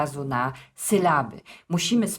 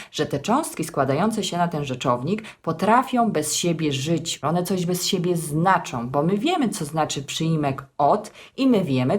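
normal talking voice

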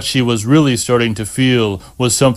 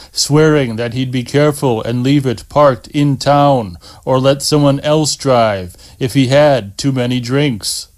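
plain reading voice

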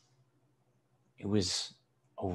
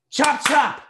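high, loud hurry-up call; impatient shout; 8 dB louder than usual. A narrator's reading. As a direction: shout to hurry the waiter along. Father impatiently shouting at waiter.

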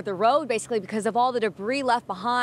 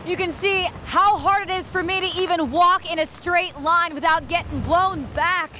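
raised voice straining over the storm; shouting over wind; 7 semitones higher than usual. Real, not acted. Reporter in hurricane wind.

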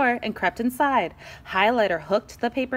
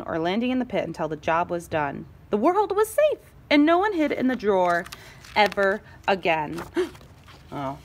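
ordinary delivery

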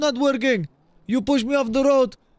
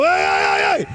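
calling out; 3 semitones higher, 3 dB louder than usual. Real, not acted. Streamer calling loudly after a woman in the street. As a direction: call after her loudly.

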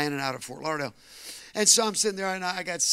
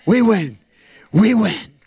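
shouted, much higher, building to triumph; full-force preaching; 9 semitones higher, 10 dB louder, slower than usual. Acted. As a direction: preach it to the rafters, shouting victory.

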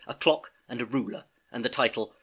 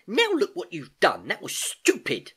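raised, higher, indignant telling-off; angry scolding; 8 semitones higher, 5 dB louder than usual. A narrator's reading. Soaked niece telling off her uncle, angry. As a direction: lean hard on the words, cross. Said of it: "exclaimed Gedanken angrily".

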